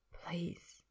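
hushed, near-whispered request; soft plea. Acted. Man gently urges his companion to continue the memory, soft whisper. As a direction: coax very softly. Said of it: "Harry says softly".

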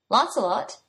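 dazed, blank question; stupidly. A narrator's reading. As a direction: sound a bit slow on the uptake. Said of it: "he said stupidly".

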